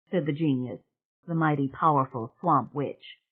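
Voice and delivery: ordinary talk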